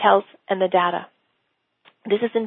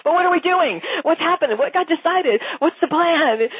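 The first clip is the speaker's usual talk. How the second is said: higher, insistent mock-puzzled questions; baffled planner colleague; 7 semitones higher than usual. Coach imitating the planner colleague in meetings, higher and baffled. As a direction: play the lost planner demanding a decision. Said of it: "He was just like".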